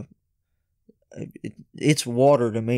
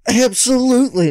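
eager yes; 11 semitones higher, 8 dB louder than usual. Retold or imitated. Eager reply to the singer.

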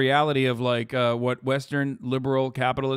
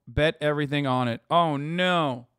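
normal talking voice

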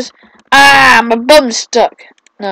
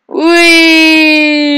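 excited whee; 7 semitones higher, 5 dB louder than usual. Real, not acted. Riding rope swing.

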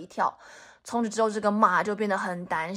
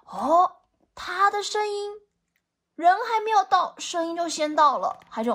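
ordinary delivery, nothing marked

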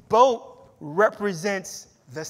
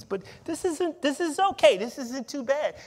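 comfy boat-sitter; 9 semitones higher than usual. Retold or imitated. Voicing the comfortable boat-sitter.

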